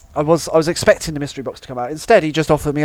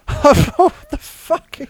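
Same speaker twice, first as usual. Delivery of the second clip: stunned disbelief; 10 semitones higher, 3 dB louder, much slower than usual. Real, not acted. Reacting to the minicab offer.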